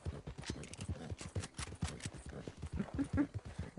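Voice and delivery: low, quiet run of chuckles; soft drawn-out chuckling; long laugh. Real, not acted. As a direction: chuckle softly for a good while. Laughs after the boar's attack.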